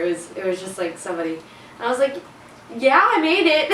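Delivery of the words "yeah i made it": high, loud bright lie; fake cheerful. Girl pretending to a family that she passed, fake cheerful. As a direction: beam through the lie. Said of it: "I didn't want to tell them".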